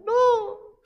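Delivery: high-pitched, hammed-up wailing promise; crying child. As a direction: wail and plead like a tearful child. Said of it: "Y viene llorando".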